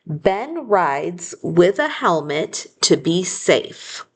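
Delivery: very slow, clear dictation of the sentence. Shown as a demonstration. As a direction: read it out slowly so children can write it. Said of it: "The next sentence is".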